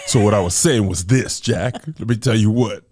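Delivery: low, firm business voice; mock tough recovery. Angry crier covering a voice crack in a deal, mock tough recovery. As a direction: drop low and act unbothered.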